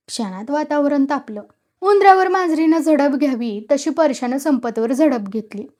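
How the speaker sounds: usual speaking voice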